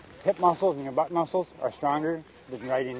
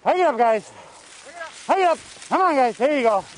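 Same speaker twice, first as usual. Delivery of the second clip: calling to dogs; 11 semitones higher, 4 dB louder than usual. Real, not acted. Musher calling commands to dogs.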